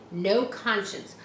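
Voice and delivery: ordinary delivery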